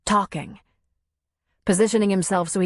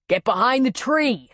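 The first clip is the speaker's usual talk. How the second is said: mild urgent yell, pitched higher; yelled order; 5 semitones higher than usual. A narrator's reading. Tom warns Nora while taking aim, yelled order. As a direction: shout a warning command, not at full volume. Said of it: "he yelled".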